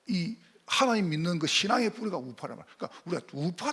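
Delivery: ordinary delivery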